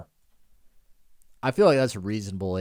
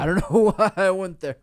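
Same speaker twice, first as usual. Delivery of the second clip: laughing; 11 semitones higher, 5 dB louder than usual. Real, not acted. Laughing echo of friend.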